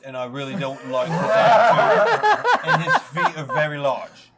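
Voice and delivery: laughing tease. Acted. Paladin teasing cleric.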